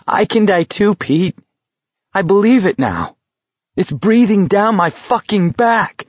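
high-pitched, rising alarm; desperate, high and agitated; panicked outburst. A narrator's reading. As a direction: let the dread push the voice up high. Acted panic about dying.

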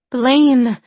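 loud, high call across the cavern. A narrator's reading. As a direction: call his name out loud. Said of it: "a voice called again".

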